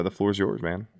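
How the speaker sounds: usual speaking voice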